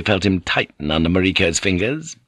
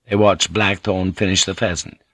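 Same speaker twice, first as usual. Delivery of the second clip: plain reading voice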